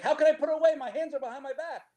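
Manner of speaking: put-on high, indignant whine of the cuffed kid; protesting kid. Cuffed kid protesting to the cops, high mock whine. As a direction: whine it like a helpless drunk kid. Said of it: "he's like".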